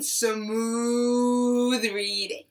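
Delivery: drawn-out smooth words; slow and smooth. Shown as a demonstration. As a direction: stretch it out slowly. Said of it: "Say that again".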